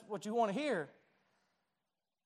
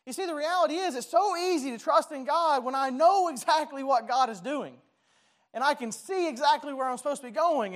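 higher, louder, building preaching; fervent preaching; 5 semitones higher, 4 dB louder than usual. Acted. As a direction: drive the point home from the pulpit. Preaching rises to a climax.

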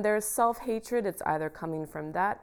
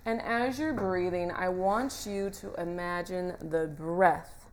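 guided meditation; 6 dB louder than usual. Acted. Leads a guided visualisation.

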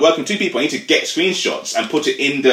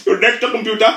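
much higher put-on voice; mock agent; 12 semitones higher, 3 dB louder than usual. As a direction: parrot the agent's phrase. Mocking agent's phrase.

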